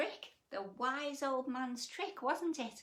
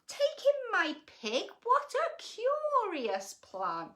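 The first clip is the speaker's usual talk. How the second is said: put-on high, puzzled old lady; can't believe the odd plan; astonished old lady; 11 semitones higher, 4 dB louder, slower than usual. A narrator's reading. Old lady baffled by the wise man's plan, high and astonished.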